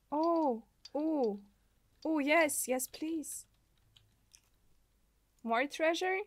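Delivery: ordinary talk, nothing marked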